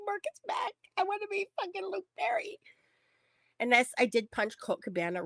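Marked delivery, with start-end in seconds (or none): 0.1-2.6 high-pitched mock fangirl whine; hammed-up high voice of a desperate fan; fan whining. Acts out overwrought fan self.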